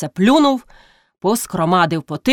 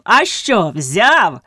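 gleeful call; much slower than usual. A narrator's reading. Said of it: "радо гукав".

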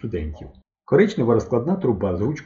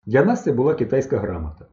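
usual speaking voice

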